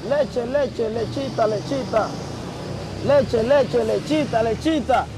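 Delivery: lightly mimicked repetitive chant; imitating crazy inmate. Prisoner imitating his crazy cellmate asking for milk, lightly acted chant. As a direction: chant it over and over like a fixated inmate, half-acted. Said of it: "Y el loco diciéndome".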